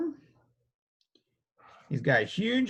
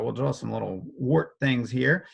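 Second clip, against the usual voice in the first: ordinary talk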